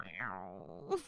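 a very low, soft meow; tiny meow. Acted. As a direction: meow small and embarrassed. Catgirl realizes what happened.

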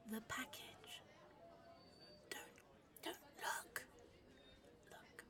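very soft urgent whisper; panicked covert whisper. Acted. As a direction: hiss the warning in his ear. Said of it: "let me whisper sweet nothings".

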